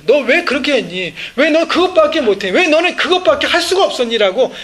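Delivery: hammed-up nagging with a laugh; preacher mocking a scolding Lord, loud and laughing. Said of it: "책망하지 않으세요".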